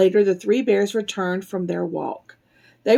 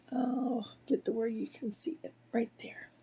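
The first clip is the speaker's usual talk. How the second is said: whispering; 15 dB quieter than usual. Real, not acted. Whispered aside to child.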